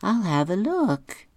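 high, slow, light female voice; Megan's voice. Retold or imitated. Megan answering Sherman about the newsletter, high light voice. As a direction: lift the voice up for Megan. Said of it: "She says".

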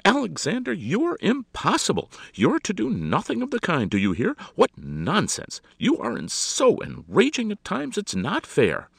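high, loud telephone scolding; hammed-up outraged lady; indignant society hostess. A narrator's reading. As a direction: lay down the law in exasperation.

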